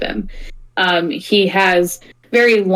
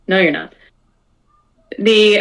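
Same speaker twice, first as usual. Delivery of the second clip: ordinary talk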